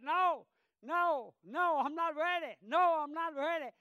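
loud, high panicked screaming of refusal; retold screaming. Dying man screaming at the preacher, loud and high. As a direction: cry out in terror, voice pushed high. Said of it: "And he was screaming out".

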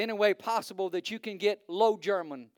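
ordinary talk, nothing marked

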